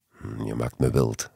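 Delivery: low, breathy panting murmur. A narrator's reading. Lover panting to narrator in the car, low and breathy. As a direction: pant it out like she can't hold back. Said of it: "hijgde ze".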